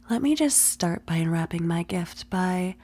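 usual speaking voice

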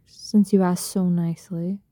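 ordinary talk, nothing marked

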